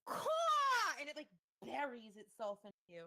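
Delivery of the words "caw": very high loud caw; loud raven screech. Acted. GM voices the raven.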